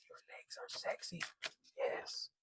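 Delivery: whispered teasing aside; soft playful whisper. Pretend. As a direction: hush it like a secret compliment. Teasing aside about sexy legs.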